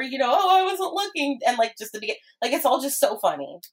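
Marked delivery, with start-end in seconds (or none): 0.3-1.4 quoting cartoon line.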